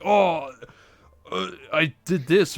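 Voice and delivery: hammed-up confession; guest confession. Talk show guest confessing, mock voice. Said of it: "It's like".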